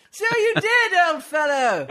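high, hammed-up cheer; the others' voices. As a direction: clap him on the back with a hearty shout. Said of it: "said the others".

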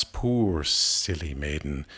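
ordinary delivery

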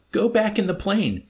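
high, loud, desperate plea; pleading. A narrator's reading. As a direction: beg him desperately. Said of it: "pleading with him now".

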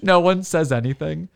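normal talking voice

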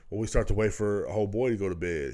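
ordinary talk, nothing marked

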